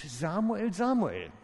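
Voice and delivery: high, loud call of God's voice; rufende Stimme Gottes. Retold or imitated. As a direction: call the name out across the dark. Gottes Stimme ruft nachts. (hohe, laute rufende Stimme)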